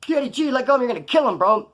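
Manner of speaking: high, pressing plea to let go; alarmed, pitch well up; retold urgent plea. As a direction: imitate talking a choker down. Retold plea to release choked man.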